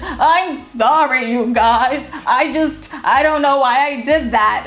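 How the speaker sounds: slow, whiny fake crying; imitating a tearless cry, dragged out; mock cry voice. Mocking a friend who fakes tears to her hosts, whiny. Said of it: "the cry voice".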